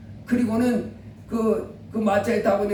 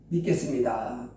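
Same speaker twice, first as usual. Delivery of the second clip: humbled reply; 10 semitones lower, 5 dB quieter than usual. Acted. The humbled son answers.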